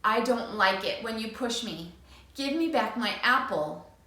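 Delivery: clear, steady big voice; firm. Acted. Boy practising his firm big voice. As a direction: stand your ground calmly. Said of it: "he firmly says".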